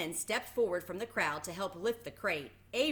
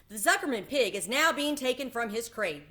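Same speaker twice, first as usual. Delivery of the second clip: louder announcer boom; booming loudspeaker; 5 semitones higher, 6 dB louder than usual. A narrator's reading. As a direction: announce it over the fairground. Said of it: "boomed the voice of the loudspeaker".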